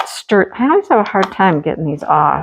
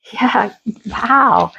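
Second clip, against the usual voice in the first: startled little wow; surprised. Real, not acted. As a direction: react to something unexpected. Flips canvas, sees surprise.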